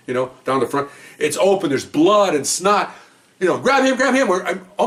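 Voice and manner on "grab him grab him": high-pitched mock cop order; retold urgent shout, pitched up; urgent calling out. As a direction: call out urgently over a brawl. Officers shouting during the brawl.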